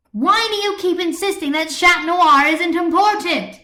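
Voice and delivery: loud, high, furious demand; angry. A narrator's reading. Marinette angrily confronting Adrian in debate, loud. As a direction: hurl the question at him. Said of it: "Marinette said angrily".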